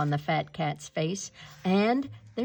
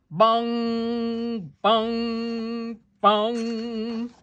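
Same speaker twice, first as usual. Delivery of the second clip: louder, ringing bell sound; bell bongs; 3 semitones higher, 4 dB louder, much slower than usual. A narrator's reading. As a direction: boom each bong out like a big church bell. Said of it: "The rope rang the big bell".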